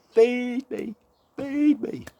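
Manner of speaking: very high, loud chick cries; shrill little chicks crying for food; baby birds begging. A narrator's reading. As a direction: squeak it out like hungry baby birds.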